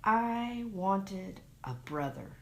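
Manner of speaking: ordinary delivery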